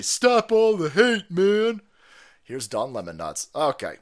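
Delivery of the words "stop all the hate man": slow, louder mock plea; lightly hammed imitation of Don Junior; mock quote. Mocking Don Junior telling people to stop the hate. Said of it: "Don Junior say".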